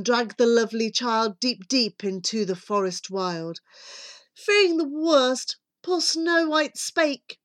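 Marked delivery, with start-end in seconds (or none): none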